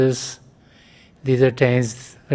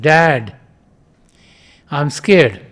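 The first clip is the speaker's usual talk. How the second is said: mild mock call to a far room, a bit louder; 4 dB louder, much slower than usual. Boy calls to his father at night, mildly raised. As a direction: call out to Dad in the next room, scared. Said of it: "called out to his father".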